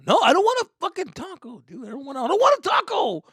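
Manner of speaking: high shouted refusal; teen refusing loudly. Retold or imitated. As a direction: snap back at dad.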